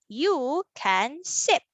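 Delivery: slow, each word held apart; word-by-word reading. Shown as a demonstration. Teacher reads another sentence for children, word by word. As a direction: read it out one word at a time. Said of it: "how about we read our sentence".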